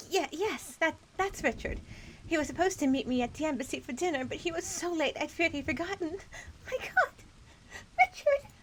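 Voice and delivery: high, rising into a wailing cry; a Victorian widow breaking down; grieving wife. Acted. Wife identifies husband's body.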